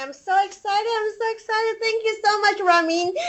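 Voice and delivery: high, bubbly and breathless with joy; gushing, barely containing the thrill; giddy excitement. Real, not acted. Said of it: "I hear my voice is shaking right now".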